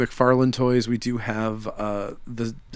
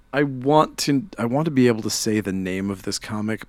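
normal talking voice

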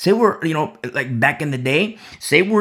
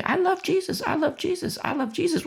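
high, soft mocking sing-song; mock sing-song claim; 12 semitones higher, 5 dB quieter than usual. A preacher mimicking someone who only says they love Jesus, high and mocking. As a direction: parody a shallow profession of faith.

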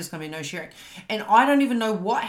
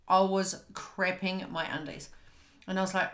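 ordinary talk, nothing marked

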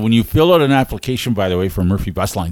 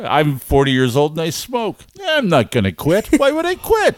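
mock stubborn; 6 semitones higher than usual. Mocking friend's stubbornness.

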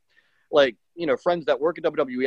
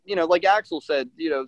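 usual speaking voice

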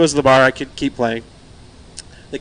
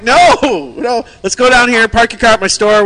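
higher, louder urging; raised, impatient coaxing; exasperated; 7 semitones higher, 4 dB louder than usual. Retold or imitated. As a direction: talk a drunk out of driving. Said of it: "I'm like".

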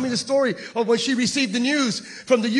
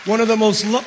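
usual speaking voice

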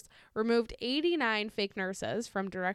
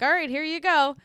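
casual clerk voice; 5 semitones higher, 7 dB louder than usual. Retold or imitated. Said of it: "they were like".

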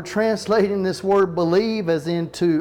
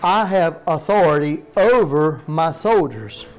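slow, louder stress through "I have authority"; emphatic underlined reading; 5 dB louder than usual. Shown as a demonstration. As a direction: hammer "I have authority over my soldiers" slowly, word by word. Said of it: "watch the underline here again".

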